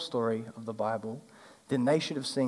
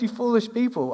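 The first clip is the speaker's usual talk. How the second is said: higher, mock-frustrated; mock exasperation; 8 semitones higher than usual. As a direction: playfully scold "you foolish people" pitched up. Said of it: "And Jesus is like".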